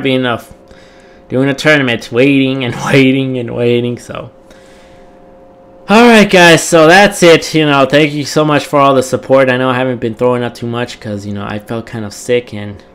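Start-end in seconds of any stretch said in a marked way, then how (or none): 5.9-8.9 upbeat sign-off. Real, not acted.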